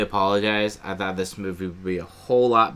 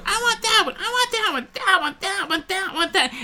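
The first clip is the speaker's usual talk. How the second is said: put-on much higher, whiny kid repeating himself; little kid. Kid insisting to dad on the ugly pumpkin, squeaky high whine. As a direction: nag like a small child fixated on one pumpkin. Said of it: "and he goes".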